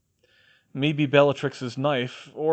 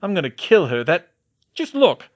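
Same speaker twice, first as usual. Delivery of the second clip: furious; 6 semitones higher, 8 dB louder than usual. A narrator's reading. George furious about the cuts.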